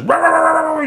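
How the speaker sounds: high-pitched mock squawking noise; imitated animal racket. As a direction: imitate a commotion heard from the yard. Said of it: "he just hears this".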